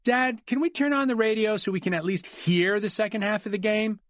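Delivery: put-on high, eager kid asking; child voice. Son asking Dad to turn on the radio, high kid voice. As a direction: ask Dad like a hopeful child.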